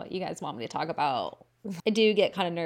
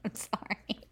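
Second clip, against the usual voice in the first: whisper; 2 semitones higher, 8 dB quieter than usual. Acted. Whispered follow-up apology.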